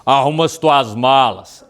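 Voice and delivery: loud, slow announcer-like voice; booming voice of God. Retold or imitated. God waking a sleeper with a command, booming announcer voice. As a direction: boom it out slowly, like God giving an order. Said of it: "parecia Deus, falando comigo, bem de locutor, assim, bem forte mesmo".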